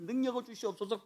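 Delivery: quiet mock prayer. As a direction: drop the prayer to a soft mutter. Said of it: "땡기면서".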